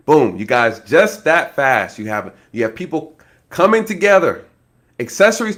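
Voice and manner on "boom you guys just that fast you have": ordinary talk, nothing marked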